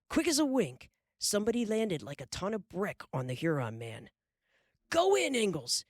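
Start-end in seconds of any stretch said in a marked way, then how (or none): 4.9-5.8 high yelled order. Acted. Mister Edwards yelling to Pa in the scuffle, high. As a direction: yell it over the crowd. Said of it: "he yelled".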